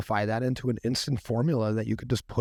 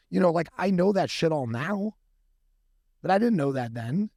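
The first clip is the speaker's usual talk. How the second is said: ordinary talk, nothing marked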